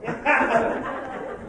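high laugh. Real, not acted. Laughs at his own story.

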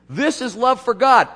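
loud, high proclamation of "This is love for God"; loud quote. Acted. Pastor quoting John to the church, loud and high. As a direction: call out "This is love for God" to the congregation.

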